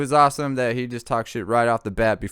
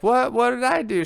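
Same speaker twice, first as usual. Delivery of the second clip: higher startled question; mock surprised; 12 semitones higher than usual. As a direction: act baffled and innocent. Retold surprised reply.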